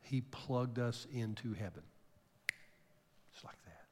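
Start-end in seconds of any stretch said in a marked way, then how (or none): 3.3-3.9 barely audible breath of a whisper; a faint reverent whisper. Real, not acted. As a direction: drop to nothing, awed. Whispered close of the story.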